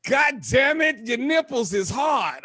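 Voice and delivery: imitating a loud shocked comment; mock shout. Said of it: "she'll jump right on the post and be like".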